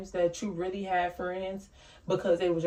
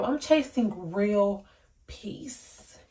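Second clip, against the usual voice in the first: slow, dropping peace to a breathy whisper; slow airy motto; much slower than usual. Shown as a demonstration. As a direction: let "peace" fade out in a whisper.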